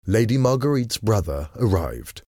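usual speaking voice

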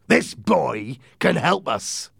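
drawn-out, emphatic pirate persuasion; pirate captain. Acted. Silver to his men about the boy, slow and insistent.

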